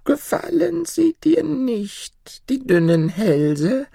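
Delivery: whispered, slow creepy crone; laughing mutter. Acted. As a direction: breathe it slowly like a gleeful witch. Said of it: "murmelte die Alte lachend".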